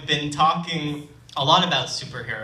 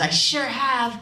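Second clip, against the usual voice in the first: put-on higher, eager little kid saying it; little boy voice; 11 semitones higher, slower than usual. Storyteller voicing the sick boy Jerry, higher kid voice. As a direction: answer like a keen five-year-old.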